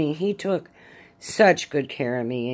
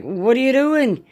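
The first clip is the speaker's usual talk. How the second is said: startled what are you doing; 9 semitones higher, 5 dB louder than usual. Retold or imitated. Demanding why he sits down.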